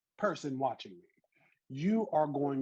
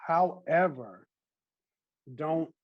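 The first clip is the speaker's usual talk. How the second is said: normal talking voice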